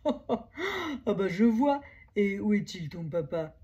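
slow, amused big-animal voice; amused bison. Acted. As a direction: speak slowly, still amused. (voix de bison amusé, lente)

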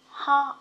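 Ho with a short o; teacher isolating the Ho syllable. Shown as a demonstration. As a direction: clip the o in Ho short. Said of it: "o ngắn nha".

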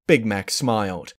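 usual speaking voice

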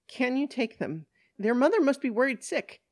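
high-pitched, straining to sound reasonable; strained reasonableness. Acted. Flustered woman asking police to take the girls, strained plea. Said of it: "tries to act more reasonable than she appears".

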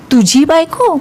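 high, shocked near-yell, pitch jumping up; almost shouting. Acted. Nidhi learning her friend is married, shocked near-shout. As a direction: blurt it out in disbelief, close to a shout. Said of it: "जवळजवळ ती ओरडतेच".

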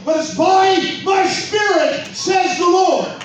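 triumphant pulpit shout; preacher's shout. Acted. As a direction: let the climax ring out. Climax of the sermon.

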